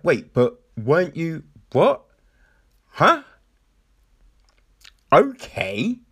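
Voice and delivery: puzzled, voice jumping up on the questions; confused. Acted. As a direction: break off in mock bewilderment. Confused viewer's reaction acted out.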